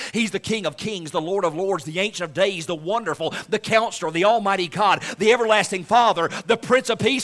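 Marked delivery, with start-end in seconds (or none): none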